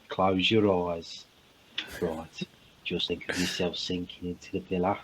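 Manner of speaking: soft, low Brummy bedtime voice; Brummy accent. Acted. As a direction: lull them to sleep in Brummy. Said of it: "you could fall asleep listening to a Brummy".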